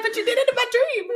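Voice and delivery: high, loud laughing whine; mock accusing retort. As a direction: wail back in a hammed-up accusation. Acts out her retort in imagined quarrel.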